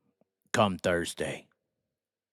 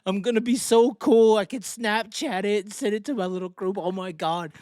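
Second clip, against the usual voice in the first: high, mocking fanboy voice; 6 semitones higher than usual. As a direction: ape a giddy fan, pitched up. Mocking fans' excited thoughts.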